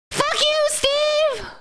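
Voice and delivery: high-pitched squirrel talking back; high, mock-angry meme squirrel; squirrel meme impression. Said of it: "It's like".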